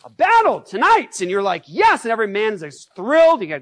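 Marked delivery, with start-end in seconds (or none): 0.0-1.2 high, loud rallying cry; commander's yell. Retold or imitated. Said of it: "and he's yelling". 1.7-2.0 eager cheer. Retold or imitated. Troops answer the commander.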